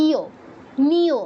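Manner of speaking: ইয়ো and নিয়ো as 'i-yo', 'ni-yo' with rounded o; two-syllable Bengali naming, ইয়ো, নিয়ো; Bengali letter name. Shown as a demonstration. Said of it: "বাংলায় বলি".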